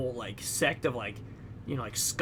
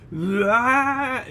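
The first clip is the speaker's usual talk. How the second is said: higher, louder mock rage cry; higher, louder unhinged cry while stomping; unhinged mock yell; 8 semitones higher, 7 dB louder than usual. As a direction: let out a short unhinged yell. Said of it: "just unhinged".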